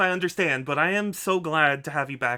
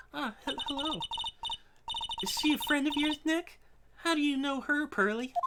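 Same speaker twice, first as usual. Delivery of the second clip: put-on much higher, timid little girl; shy girl voice; 11 semitones higher, 3 dB quieter than usual. Voicing a shy young girl meeting a stranger, timid and high. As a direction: pipe up like a shy child.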